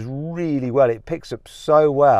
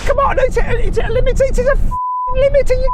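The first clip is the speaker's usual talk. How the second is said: frustrated outburst; 3 dB louder than usual. Real, not acted. Car hits speed limiter.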